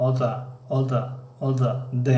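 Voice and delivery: "Oda" with the t almost a d; "Oda" the American way, t nearly d; flapped t. Shown as a demonstration. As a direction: repeat "Oda" with a soft flapped t. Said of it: "моил ё ин қариб ба ҳарфи д аст".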